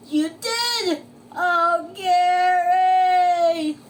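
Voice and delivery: high, loud drawn-out wail; SpongeBob wailing. Acted. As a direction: howl the name in anguish. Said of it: "wailed SpongeBob".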